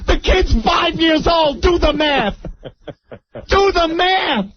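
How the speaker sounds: high-pitched incredulous exclamation; hammed-up, very high protest; mock outrage. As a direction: hammer it out in comic disbelief. Comic outrage at the grandkids claim.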